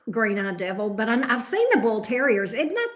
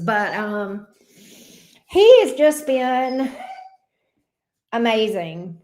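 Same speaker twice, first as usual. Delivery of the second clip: normal talking voice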